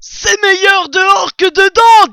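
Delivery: exclamation très haute et très forte; boutade criée. A narrator's reading. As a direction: lance la plaisanterie à toute la tablée. Blaireau lance une boutade au banquet. (blaireau qui claironne, voix aiguë)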